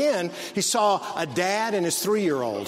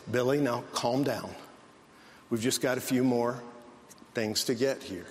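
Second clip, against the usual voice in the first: lower, softer soothing dad voice; calm dad; 4 semitones lower, 4 dB quieter than usual. Retold or imitated. Dad calmly reassuring his son Billy in the store. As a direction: soothe a fussy kid evenly. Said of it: "the dad is as calm as he could be".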